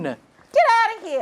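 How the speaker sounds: high, loud order to leave; angry dismissal. Acted. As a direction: throw the intern out furiously. Boss throws intern out.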